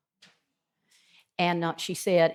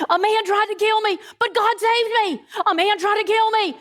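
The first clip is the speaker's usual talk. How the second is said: much higher and louder, a sobbing wail of relief; hysterical weeping; 8 dB louder than usual. Retold or imitated. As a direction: wail it out, weeping and overjoyed at once. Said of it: "I was absolutely hysterical".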